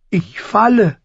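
high-pitched alarmed cry. A narrator's reading. Falling boy contradicts the crow, alarmed. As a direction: pitch it up like a panicking child.

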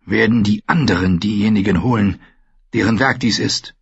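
ordinary delivery, nothing marked

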